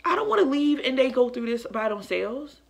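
high, hurt retold protest; hurt, frustrated. Mother unwilling to leave her family behind, hurt and frustrated. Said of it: "I'm literally mad and I'm frustrated and I'm hurt".

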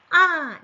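아 shouted high, dropping fast; loud falling 아; sharp falling tone. Shown as a demonstration. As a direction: hit 아 as a sharp fourth-tone fall. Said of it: "이 소리가 나셔야 되죠".

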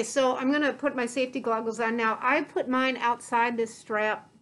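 muffled behind respirator; mask-covered mouth; talking through a face mask. Real, not acted.

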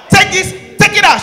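high loud command; mock shout. Husband ordering wife to take food away, high mock shout.

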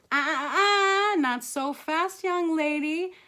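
loud, high, slow warning; singsong motherly reprimand; scolding mom. Acted. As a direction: halt a kid at the door, drawing each word out. Mother stops Gabby leaving.

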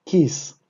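kiss, with a short i and a falling close; Hebrew word kiss. Shown as a demonstration. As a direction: put the syllables together into kiss. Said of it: "esto dice kiss". («kiss» pronunciado como palabra completa)

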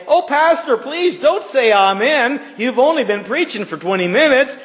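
high, pleading, overeager plea; eager churchgoer. Pretend. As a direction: beg the pastor not to stop, hammed up. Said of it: "Do we talk like that?".

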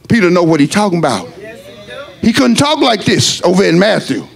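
usual speaking voice

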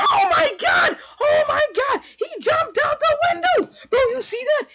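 very high, full-volume shrieking; shocked scream. Retold or imitated. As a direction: scream in amazed disbelief at what was just seen. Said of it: "I was like".

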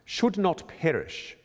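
Should not perish, D T P crisply hit and slow; strong consonants. Shown as a demonstration. Choir director demonstrating the consonants of should not perish. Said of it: "consonants here that need to be really strongly".

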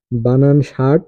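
normal talking voice

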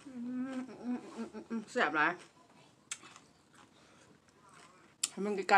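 talking while chewing; chewing food. Real, not acted. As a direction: mouth partly full. Seen 0: Bites food before, lips work through "mm mm delicious"; refills at 3.5s.

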